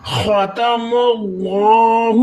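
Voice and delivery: ختم اللہ very slow, with thick lam in اللہ; slow tajweed ختم اللہ, tongue-thickened lam; heavy lam. Shown as a demonstration. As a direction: recite ختم اللہ with heavy kha and a long, full اللہ. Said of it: "الف والا لام موٹا ہوگا".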